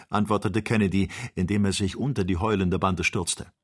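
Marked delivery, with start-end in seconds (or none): none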